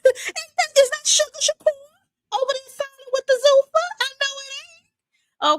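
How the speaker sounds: very high, loud, stunned quoting; very high shocked mock wail; shocked disbelief. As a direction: squeal it out in disbelief. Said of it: "I said".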